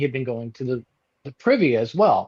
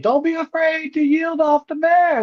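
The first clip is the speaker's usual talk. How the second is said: mock caretaker; 13 semitones higher than usual. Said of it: "the caretaker was, like, saying".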